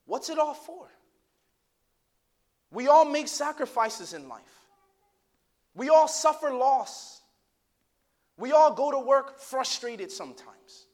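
loud, high, slow sermon climax; preaching at full force. Acted. Preacher urging his congregation, loud and slow. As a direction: press each line on the congregation slowly.

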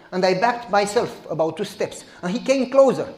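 ordinary talk, nothing marked

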